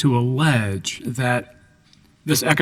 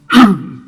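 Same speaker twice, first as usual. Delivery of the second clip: quick throat clear; 5 semitones higher, 8 dB louder than usual. Real, not acted. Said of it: "pardon my scratchy voice".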